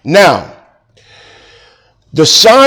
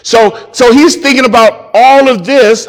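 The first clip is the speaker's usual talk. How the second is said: usual speaking voice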